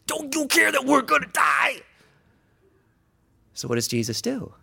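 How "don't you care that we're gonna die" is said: high, loud desperate shout; panicked scream. Acted. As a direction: scream it in his face. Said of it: "they're screaming in his face".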